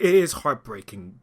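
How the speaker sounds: usual speaking voice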